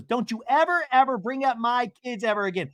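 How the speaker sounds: high-pitched indignant retelling; mock outraged warning. Mother warning castmate about her kids, high mock outrage. Acted quarrel, Brittany warning Mary.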